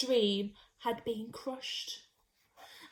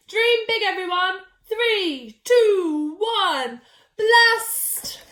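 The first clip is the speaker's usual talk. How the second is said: excited countdown; 5 semitones higher, 8 dB louder, much slower than usual. Acted. Countdown to rocket launch.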